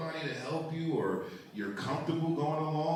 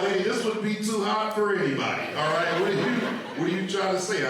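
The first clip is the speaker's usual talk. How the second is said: loud, higher-pitched comic protest; indignant retort; 6 semitones higher, 4 dB louder than usual. Retold or imitated. Customer protesting to the salon lady, indignant. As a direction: push back at her, raised and affronted.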